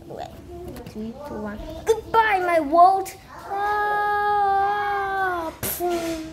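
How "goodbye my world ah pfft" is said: high, loud mock goodbye; dramatic farewell. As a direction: cry it out as you leap. Jumping off the tower.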